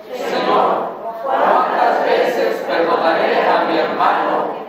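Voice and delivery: many voices reading the verse together; group reading aloud. Real, not acted. Congregation answers the pastor, reading in unison.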